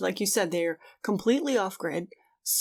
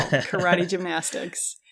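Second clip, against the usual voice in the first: slow savoring; slower than usual. Acted. Repeating the silly skill.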